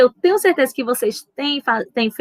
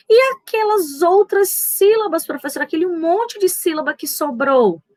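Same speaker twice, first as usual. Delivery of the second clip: higher, quick questioning pupil; lightly voiced pupil, pitched up; imagined pupil protesting; 7 semitones higher than usual. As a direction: ask like a puzzled pupil pressing the teacher. Voices a pupil pressing the teacher.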